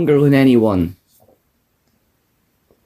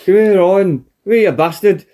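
Bulldog impression; 5 semitones higher than usual. Co-host mocks Bulldog, calling Owen over, a high hammed-up British voice. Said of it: "you talk with the [name] shit".

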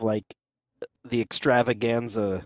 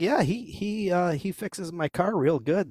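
lightly acted, higher-pitched casual praise; imagined customer; 6 semitones higher than usual. Customer talking about the mechanic, lightly acted.

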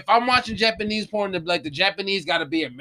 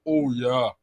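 mock deep moan; 9 semitones lower than usual. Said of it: "when they moan, it's super deep".

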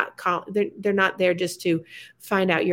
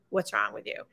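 lower, softer self-quote; 9 semitones lower, 7 dB quieter than usual. Retold or imitated. Host mimics her own question to co-host, lower. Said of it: "I say".

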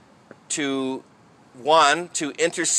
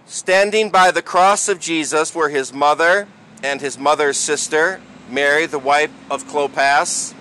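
solemn proclamation read aloud; gospel reading. Real, not acted.

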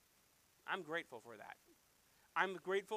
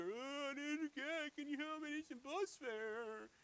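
high, drawn-out mock whine; hammed-up begging, words stretched out; exaggerated whining; 9 semitones higher, slower than usual. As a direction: whine it like a pleading beggar. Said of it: "And you whine like that".

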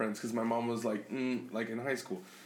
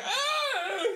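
high yelp with a laugh; mock startled scream. Son acting his reaction to dad's clap, mock scream.

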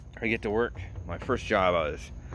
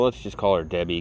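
ordinary talk, nothing marked

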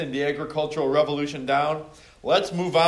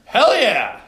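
loud hype shout of approval; excited cheer; 9 semitones higher, 10 dB louder than usual. Real, not acted. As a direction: cheer the answer loudly. Cheering a student's answer.

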